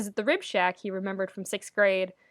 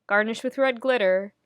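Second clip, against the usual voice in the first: ordinary delivery, nothing marked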